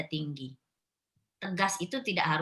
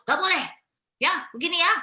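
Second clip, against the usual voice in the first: much higher and louder snapping no; mock-shrill mother raising her voice; raised-pitch scolding; 11 semitones higher, 7 dB louder, faster than usual. Shown as a demonstration. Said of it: "habis itu naik lagi nadanya".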